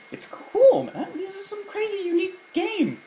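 squeaky high-pitched joking voice; high mock voice. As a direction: say it in a silly high voice. Mocking own enthusiasm.

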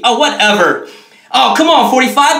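ordinary talk, nothing marked

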